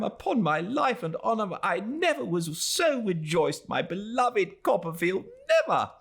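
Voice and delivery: put-on high, overjoyed old friend; excited Traddles. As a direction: gush with delight at a reunion. Traddles gushes with joy.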